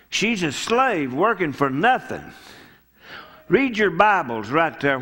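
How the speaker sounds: ordinary talk, nothing marked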